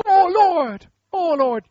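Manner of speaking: loud, high mock wail; despairing wail. Joshua lying in the dust calling to God, mock wail. Acts Joshua wailing again.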